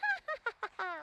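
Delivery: high, thin giggling gnome laugh; gnome laughter. A narrator's reading. Gnomes in the tree laughing at the lizard, high giggle. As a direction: cackle like a mischievous little gnome. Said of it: "the gnomes in the tree just laughed and laughed".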